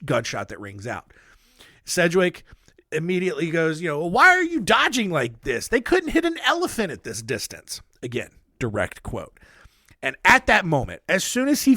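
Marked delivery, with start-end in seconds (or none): 4.0-7.8 high-pitched, exasperated mock scolding; acted scoffing. General to men dodging sniper fire, acted scoffing.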